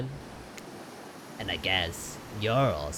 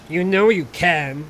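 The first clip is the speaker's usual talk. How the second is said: character voice; 10 semitones higher, 11 dB louder than usual. Acted.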